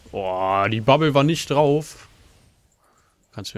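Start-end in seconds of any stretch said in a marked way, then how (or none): 0.1-2.1 loud, high frustrated yell; angry outburst. Real, not acted. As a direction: shout it out in disbelief. Outburst at failed game move.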